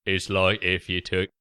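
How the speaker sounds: nasal hobgoblin take on Michael Caine; ogre-like Michael Caine impression. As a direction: put on a bloated ogre voice. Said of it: "your sort of ogre voice".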